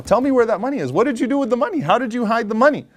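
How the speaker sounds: high-pitched, pleading interrogation; insistent demand. Retold or imitated. Man questions al-Bukhari about the hidden money, insistent demand.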